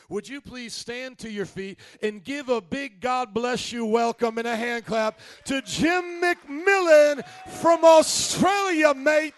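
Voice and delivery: booming, stretched-out announcer welcome; rising to a full-throated shout for the guest; rousing introduction. Real, not acted. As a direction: call him up like a ringmaster, slowing down. Host calling out guest introduction.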